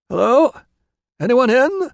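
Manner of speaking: high, loud call over the gate; calling out to find anyone home; shouted call. Acted. Visitor at the back gate shouting, loud. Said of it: "a voice shouted in Latin".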